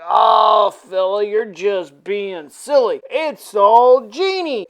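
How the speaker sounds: put-on slow, loose comic drunk. Drunk Clifton waving away Frank's warning, comic drunken slur. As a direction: draw the words out like a tipsy friend. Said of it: "Clifton's drunk ass".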